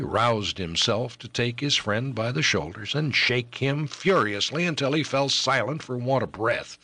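plain reading voice

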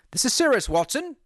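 alarmed detective raising his voice; urgent cry. A narrator's reading. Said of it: "he cried".

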